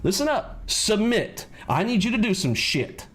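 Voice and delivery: high-pitched mock order to wife; hammed-up domineering husband; husband ordering his wife, mock bossy; mock commanding husband. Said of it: "and be like".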